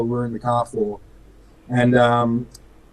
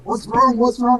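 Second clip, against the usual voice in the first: alarmed question; 9 semitones higher than usual. Retold or imitated. Retelling someone's alarmed question.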